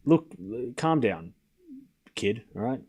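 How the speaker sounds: lightly voiced older-guy scolding; mock soothing. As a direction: hush them down quietly. Mock telling someone off.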